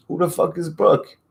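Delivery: high-pitched incredulous question; baffled retort. Retold or imitated. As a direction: throw it back in mock bewilderment. Retold baffled reply to accusation.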